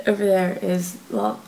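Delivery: normal talking voice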